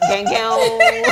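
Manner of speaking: high catchphrase imitation with laughter; Zazu. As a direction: squeal out a playful mock ad-lib. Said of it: "So it's Zazu that does that".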